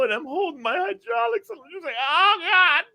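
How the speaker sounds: high, strained pained squeal; mock agony. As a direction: squeak it out while clutching yourself. Retells clutching himself in the snow.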